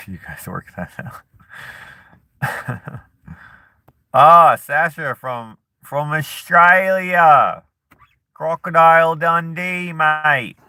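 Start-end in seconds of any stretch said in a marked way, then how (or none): 4.1-10.6 put-on loud, high, drawn-out Aussie accent; Australian accent. Vlogger greeting an Australian viewer, hammed-up Australian accent. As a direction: ham up a big slow Australian accent. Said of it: "That's the best accent. Australian?".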